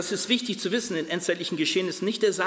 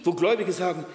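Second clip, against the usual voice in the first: normal talking voice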